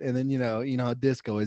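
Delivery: normal talking voice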